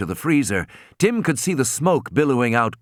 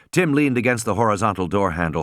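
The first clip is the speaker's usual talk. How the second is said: usual speaking voice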